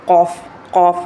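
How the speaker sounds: heavy, back-of-throat ق in "قاف"; rounded heavy "قاف", unlike a light kaaf; thick qaaf. Shown as a demonstration. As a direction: say "قاف" full and thick from deep in the mouth. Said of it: "اس کو موٹا پڑھتے ہیں".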